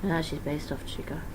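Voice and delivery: ordinary delivery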